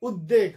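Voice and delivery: উদ্বেগ said as ud-deg; mistaken doubled d. Shown as a demonstration. Teacher repeating students' mistake, ud-deg. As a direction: turn the ব of উদ্বেগ into a second d. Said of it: "যে ভুল উচ্চারণটা শুনি আমরা".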